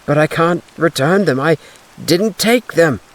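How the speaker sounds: high, urgent denial; desperate denial. Acted. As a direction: plead in panic. Accused denies again.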